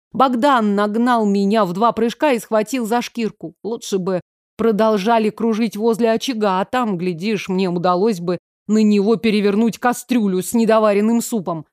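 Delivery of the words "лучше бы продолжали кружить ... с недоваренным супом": ordinary delivery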